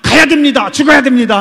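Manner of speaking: loud, high, instant yes; eager blurt. Retold or imitated. Preacher mimics Gyeongsang and Jeolla folks answering at once, loud and eager. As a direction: blurt it out without thinking, loud. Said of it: "경상도 사람들이나 전라도 사람들은 바로 그냥".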